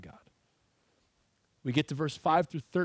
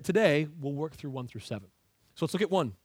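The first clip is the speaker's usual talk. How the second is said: ordinary delivery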